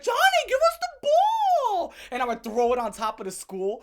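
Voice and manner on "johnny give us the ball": very high, loud whiny shout; high loud yell; angry kids yelling. Retold or imitated. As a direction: shout like kids robbed of their ball.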